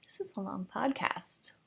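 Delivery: soft whispered aside. Real, not acted. Whispering an aside to viewers. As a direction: whisper it under your breath.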